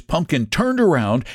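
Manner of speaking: plain reading voice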